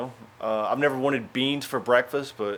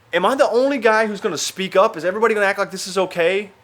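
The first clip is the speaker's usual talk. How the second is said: raised-pitch exasperated outburst; louder, high-pitched indignant questions; 8 semitones higher, 4 dB louder than usual. Acted. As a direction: demand an answer from a silent room. Acted indignation in hostel anecdote.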